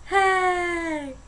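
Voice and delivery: ordinary talk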